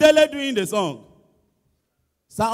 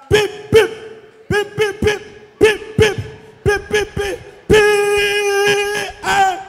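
high, loud pip pip calls; piping chant; 9 semitones higher, 6 dB louder than usual. Retold or imitated. Comedian ends the kids' song with high pip calls. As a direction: chirp it like excited kids.

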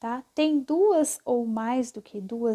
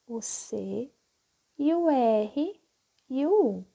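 ordinary talk, nothing marked

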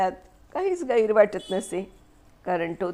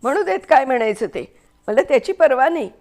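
loud, high, fast furious scream; 5 semitones higher, 7 dB louder, faster than usual. A narrator's reading. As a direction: yell it defiantly at him. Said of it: "Mary किंचाळून".

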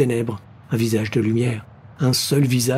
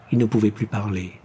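ordinary delivery, nothing marked

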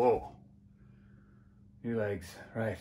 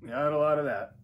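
higher, louder repeat with stress on lot; louder, higher echo of the first try; 6 semitones higher, 5 dB louder than usual. Real, not acted. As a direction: say it again, punching lot. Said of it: "whoa, got a lot of that".

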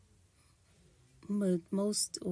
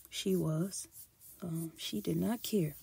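normal talking voice

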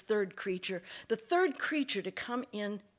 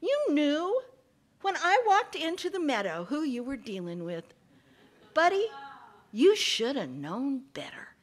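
higher, ending in a hushed whisper; gloating fox; 6 semitones higher, 5 dB louder than usual. Acted. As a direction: savour the lesson. Fox taunts snake.

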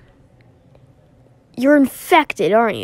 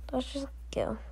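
hushed whisper to slip away; sneaky whisper; 2 semitones higher, 15 dB quieter than usual. Acted. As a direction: whisper it so nobody hears.

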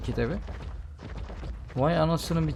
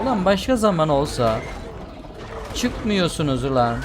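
annoyed scolding; 5 semitones higher than usual. Real, not acted. Scolding the monsters.

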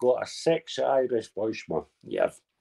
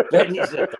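high-pitched laughter running into words; laughing; 8 semitones higher than usual. Real, not acted. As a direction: start the jibe while still laughing. Laughing while starting a jibe.

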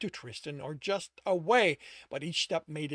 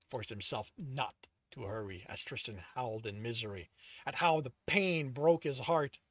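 ordinary delivery, nothing marked

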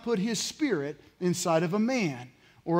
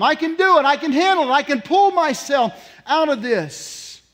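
loud, raised-pitch self-assurance; acted insistence; 6 semitones higher, 6 dB louder than usual. Counselor's client refusing help, loud acted insistence. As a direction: insist you need nobody. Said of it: "she hears stuff like this".

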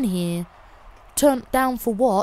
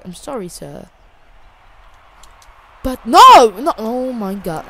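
usual speaking voice

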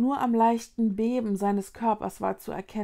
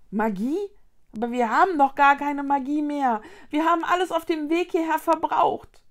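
loud, higher protest; dismayed outburst; 6 semitones higher, 6 dB louder than usual. A narrator's reading. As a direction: object in dismay, pushing the voice. Mila dismayed that magic is gone.

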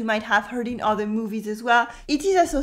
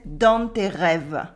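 slow southern French "dans tes rêves", N sounded in dans; southern French "dans tes rêves", ending rêv-uh; southern French accent; 6 semitones lower, slower than usual. Shown as a demonstration. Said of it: "pronouncing the N in nasal vowels".

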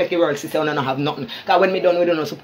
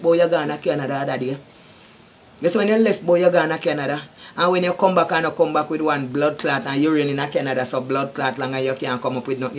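ordinary talk

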